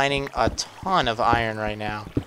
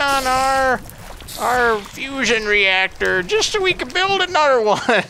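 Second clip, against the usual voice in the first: high, quavering grandpa voice; doddering old-timer rambling on; very old man; 10 semitones higher, 3 dB louder than usual. Pretend. Old-timer reminiscing in Minecraft.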